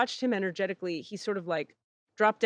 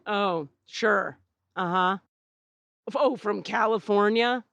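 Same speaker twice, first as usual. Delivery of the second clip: dismissive eye roll. Retold or imitated. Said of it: "Like, eye roll".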